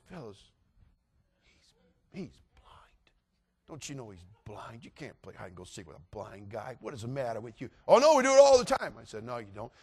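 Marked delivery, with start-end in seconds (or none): 0.1-7.7 urgent near-whisper, very soft; hushed warning. Retold or imitated. Secretly warning friends about blindness. 7.9-8.9 put-on high, loud kid voice; breezy loud reply. Kids answer his hushed warning. 9.2-9.7 low, soft protest; hushed objection. Retold or imitated. Hushed arguing back to kids.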